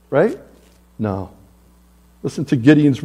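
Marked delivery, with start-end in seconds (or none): none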